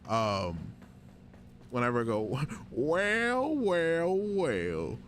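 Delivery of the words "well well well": lightly voiced old miner; a hint of a cranky old miner; old prospector. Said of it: "crankety old miner".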